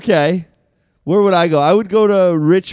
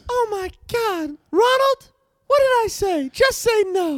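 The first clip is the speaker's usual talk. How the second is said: much higher scolding wife voice, hammed up; shrill-free but far higher mock first lady; Nancy Reagan; 13 semitones higher than usual. Wife scolds Ronald over drugs, much higher mock Nancy voice. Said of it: "It's Nancy Reagan".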